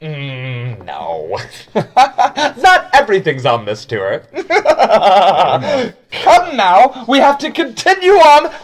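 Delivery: high, excited guide voice breaking into laughs; chirpy guide, giggling as he urges them on; tour guide character. Acted. Tour guide laughing as he urges the group onward. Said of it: "He goes".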